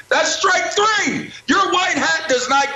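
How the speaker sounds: high, slow shouted count; emphatic indictment. Real, not acted. Commentator counting the referee's strikes, emphatic high shout. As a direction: call it out high and drawn out.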